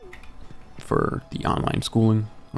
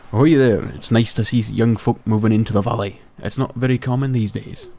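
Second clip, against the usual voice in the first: hearty Scottish-tinged sailor brogue; Willie, Scottish accent. Acted. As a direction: play an old fisherman with a makeshift Scottish accent. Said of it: "do a few, few voices".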